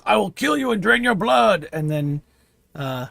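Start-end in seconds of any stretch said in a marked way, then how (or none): 0.1-1.7 giant voice. Acted. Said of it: "Giant says".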